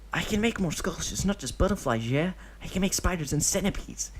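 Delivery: put-on high boyish bragging; a kid showing off to win him over; eager child. Deidara selling his sculptures to Obito, high eager child voice.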